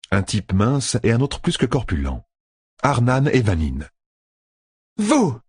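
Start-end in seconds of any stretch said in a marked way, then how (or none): 5.0-5.4 high cry of recognition; startled exclamation. A narrator's reading. Fail recognises the two riders, exclaiming. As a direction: exclaim it high, taken by surprise. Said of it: "s'écria Fail".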